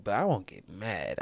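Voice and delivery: usual speaking voice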